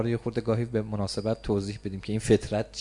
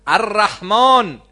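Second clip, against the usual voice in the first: drawn-out الرحمن, much higher and louder; a slow, ringing, higher proclamation of الرحمن; proclaimed; 9 semitones higher, 12 dB louder, much slower than usual. Real, not acted. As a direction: cry out the name slowly from the pulpit. Preacher proclaims the divine name.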